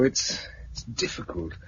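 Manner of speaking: slow and unsure, almost under the breath; hesitant whisper. Acted. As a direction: admit it haltingly, barely voiced. Hesitant admission after a sigh.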